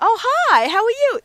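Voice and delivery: high, bright mock-welcome from the attendants; imitating cheerful greeting. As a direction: chirp it like a delighted parking attendant. Parking attendants greeting her warmly.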